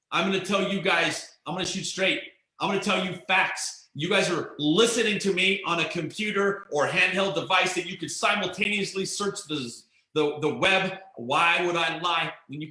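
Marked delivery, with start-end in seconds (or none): none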